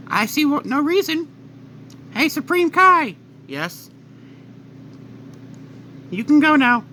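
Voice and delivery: King Kai. Acted. King Kai voiced, dismissing Supreme Kai.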